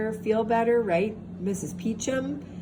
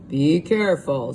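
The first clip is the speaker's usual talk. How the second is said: lower, louder cautious warning; Papa Bear's warning; 4 semitones lower, 5 dB louder than usual. A narrator's reading. Papa Bear warning his family at the door, low and firm. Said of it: "said Papa Bear".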